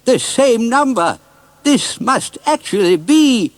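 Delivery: high, loud and slow with amazement; excited shout. Acted. Mister Oldcastle realising the car is Gumdrop, high excited shout. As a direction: shout it in disbelief. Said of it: "he shouted".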